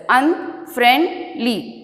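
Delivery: "Unfriendly" slowly split into un, friend, ly; syllable by syllable. Shown as a demonstration. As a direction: separate each syllable of "Unfriendly". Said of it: "Now I am splitting the words".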